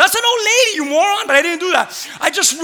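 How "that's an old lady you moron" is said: high loud pretend scolding; mock angry retort. Pastor voicing what he wanted to yell, mock angry. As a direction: snap it as the reply he held back. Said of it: "a part of me, I wanted to".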